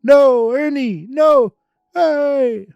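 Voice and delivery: hey hey hey, stop him taking over; indignant shouting. Acted. Ernie shouts at the one taking his organ, high loud protest.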